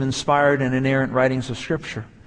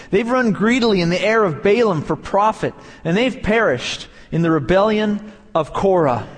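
forceful scripture reading; 3 semitones higher than usual. Acted. Preacher declaring Jude's woes over false teachers, forceful. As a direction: preach the woes with full weight, each line falling hard.

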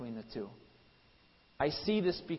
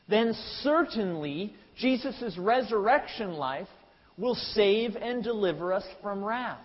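slower, louder preaching of Paul's words; preacher voicing the apostle, slow and louder; voicing Paul's plea; 4 semitones higher, 4 dB louder than usual. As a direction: plead Paul's argument to the congregation, measured. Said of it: "He is saying this".

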